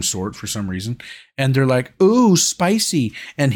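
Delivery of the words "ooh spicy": high, slow, campy Ooh; hammed-up flirty streamer; mock streamer voice. Mocking the NPC streamers on TikTok, campy and high.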